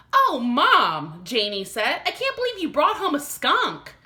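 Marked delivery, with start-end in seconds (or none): 0.1-1.2 dismayed cry. Acted. Girl dismayed at mother. 2.1-3.9 amazed disbelief. Acted. Girl amazed at skunk.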